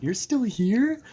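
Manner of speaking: high, loud incredulous question; astonished friend. Retold or imitated. Imitating stoned friend back from the kitchen, high astonished voice.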